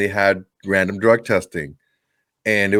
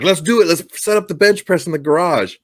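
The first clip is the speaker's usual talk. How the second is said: eager, higher-pitched goading; retold egging-on; 6 semitones higher than usual. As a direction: act out excited buddies urging a dare. Retold friends egging him on.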